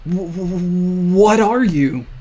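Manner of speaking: a stammering, startled question that jumps up high and loud. Acted. Younger self meeting his future self, startled stammer. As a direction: stumble over the words, then blurt the question.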